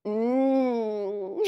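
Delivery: a contented tasting hum; happy tummy-rubbing mmm; yummy mm. Shown as a demonstration. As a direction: hum as if the jam tastes delicious. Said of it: "Can you rub both of your tummies".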